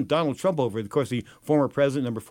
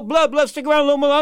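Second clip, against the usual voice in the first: higher, louder coaxing boss voice; Don King coaxing; 11 semitones higher, 6 dB louder than usual. Retold or imitated. Don King urges his tired worker to stay, higher pleading.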